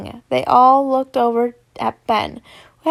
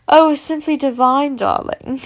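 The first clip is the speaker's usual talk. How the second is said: put-on British accent; 6 dB louder than usual. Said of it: "he says in a British accent".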